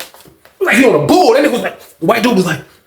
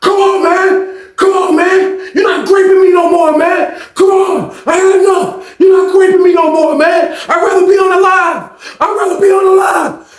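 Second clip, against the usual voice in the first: much higher, louder raging shout; psycho yell; 10 semitones higher, 3 dB louder than usual. Retold or imitated. John snaps at his tormentor, raging shout. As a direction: scream it like you've snapped. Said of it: "John turned into a crazy psycho".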